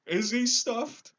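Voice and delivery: high, loud, slow amazed question; the lion can't believe it; lion gasping in surprise; surprised lion. Acted. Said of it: "asked the lion in surprise".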